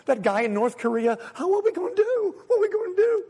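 high, whiny mock panic; panicked worrier. God biting his nails over world news, mock panic. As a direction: fret like a nervous wreck, voice climbing high. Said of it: "God's in heaven just biting his nails".

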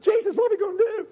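high, whiny mock panic; panicked worrier. God biting his nails over world news, mock panic. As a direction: fret like a nervous wreck, voice climbing high. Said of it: "God's in heaven just biting his nails".

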